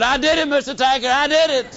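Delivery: put-on a boy eagerly owning up in relief; relieved. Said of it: "enormously relieved".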